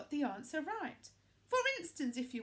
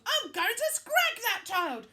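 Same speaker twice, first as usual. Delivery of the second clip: queen yelling; 2 semitones lower, 8 dB louder than usual. A narrator's reading. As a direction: scream threats like a raging queen. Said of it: "she yelled".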